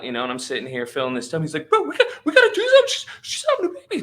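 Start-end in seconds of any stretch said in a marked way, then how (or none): 1.7-4.0 racing, squeaky-high mock panic; freaking out. Buddy urging calm friend to do something, mock freak-out. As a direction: imitate a frantic buddy, very fast and high. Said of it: "He starts, like, freaking out".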